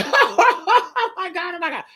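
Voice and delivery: big open guffaw into "oh my God"; hearty demonstration laugh; masculine laugh. Shown as a demonstration. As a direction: laugh out loud, mock-masculine. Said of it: "Very masculine".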